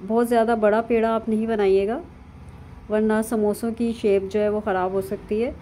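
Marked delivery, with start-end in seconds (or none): none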